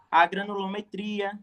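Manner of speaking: normal talking voice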